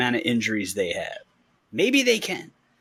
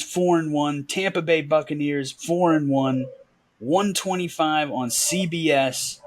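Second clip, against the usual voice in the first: ordinary talk, nothing marked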